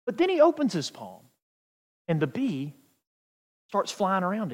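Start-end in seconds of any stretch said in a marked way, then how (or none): none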